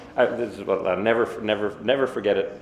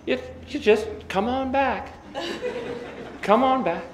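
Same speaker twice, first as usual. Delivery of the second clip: cheery mom again. Retold or imitated. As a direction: sweetly insist he come back home. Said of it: "But she persists".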